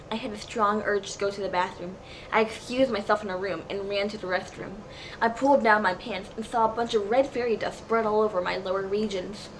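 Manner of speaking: ordinary delivery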